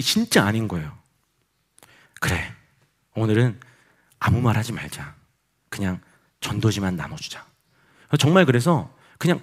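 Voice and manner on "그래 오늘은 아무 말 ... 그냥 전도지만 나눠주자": low, soft talk to oneself; resigned self-talk. Retold or imitated. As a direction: murmur a deflated decision to yourself. Dejected talk to self in retelling.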